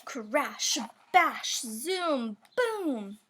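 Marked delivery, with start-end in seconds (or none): none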